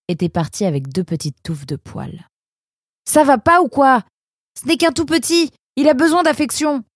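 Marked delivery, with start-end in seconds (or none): 3.1-6.8 much higher and louder outraged plea; indignant protest. A narrator's reading. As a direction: protest hotly for the baby.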